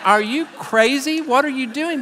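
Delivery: wife's disbelief. Retold or imitated. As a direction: ask as a baffled wife would. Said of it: "She would look at me like".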